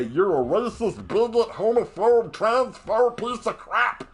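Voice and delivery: slow, loud, rising mock accusation; angry slow tirade of slurs; losing my cool. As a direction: spit each insult out slowly. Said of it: "Sorry, kinda lost my cool there".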